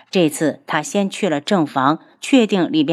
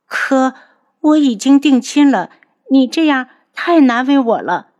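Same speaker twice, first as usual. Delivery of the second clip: put-on higher, louder girl's voice, pleading her case; girl reasoning; 6 semitones higher, 4 dB louder than usual. Captive girl argues she is already engaged, high and urgent. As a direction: push back tearfully but reasonably. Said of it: "女子试着讲道理".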